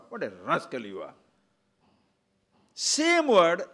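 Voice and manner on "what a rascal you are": mildly scornful, lower; a touch of contempt on What a rascal you are; insulting. Shown as a demonstration. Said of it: "Depends on your intonation".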